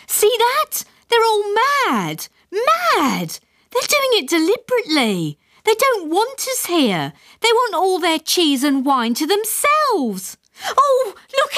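high frantic panicked wife; panicked mother. A narrator's reading. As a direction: shriek it in rising panic.

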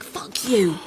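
loud, furious outburst; furious curse. Acted. Woman cursing her ex at the quarrel's peak, furious.

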